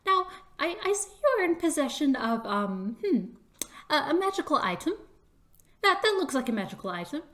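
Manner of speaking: high, hesitant little official; small voice politely probing, quick bursts; mouse official. Acted. Mouse official questions Chris about the item, high and tentative. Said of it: "the worn mouse goes".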